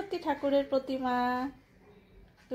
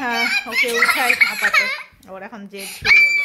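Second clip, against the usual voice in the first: laughing; 11 dB louder than usual. Real, not acted. Mother laughs at her stubborn son, loud laughter.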